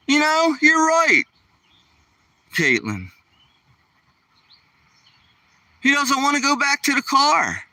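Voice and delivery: light high voice, teasing the viewer along; playful high voice. Real, not acted. Answering viewer Caitlin about his stubborn dog, playful high voice.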